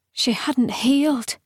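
ordinary delivery

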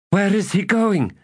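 high-pitched alarmed shout, little added volume. A narrator's reading. Destriant sees the hunter run off, high shout. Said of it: "Calith shouted".

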